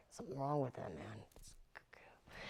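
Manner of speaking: trailing into a whisper; muttered aside. Retold or imitated. Actress muttering to herself about co-star, dropping to whisper. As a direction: mutter it under your breath, fading away.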